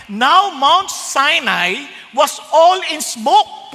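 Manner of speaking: slow, deliberate reading; scripture reading. Acted. Preacher reading the Sinai verse to the congregation, slow and vivid. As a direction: set the scene slowly.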